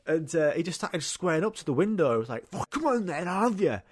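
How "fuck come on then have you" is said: high, mock-aggressive drunk taunt; drunk challenge. Drunk man at the window taunting the narrator, mock aggressive. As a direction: imitate a drunk lad picking a fight.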